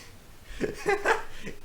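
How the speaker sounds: high breathy villain cackle; evil laugh. Acted. Brownbeard gloating over the kids, high evil cackle.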